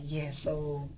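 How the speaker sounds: low, quiet mock admission; imitating sheepish confession. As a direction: quietly own up as the retold son. Retold son's awkward confession to mom.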